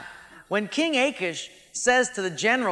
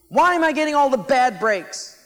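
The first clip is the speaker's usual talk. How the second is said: higher and louder, indignant; mock outrage; 6 semitones higher, 8 dB louder than usual. Preacher voicing David's anger at being sent home, indignant. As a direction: play David's hurt fury.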